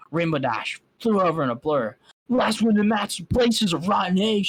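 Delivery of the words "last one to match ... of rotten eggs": shouting. Acted. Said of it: "she shouted".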